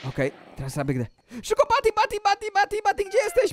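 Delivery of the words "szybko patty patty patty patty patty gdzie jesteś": high-pitched urgent repeated calls; frantic calling. Pretend. Wolf searching for captured Patty, frantic. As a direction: call her name in a rush.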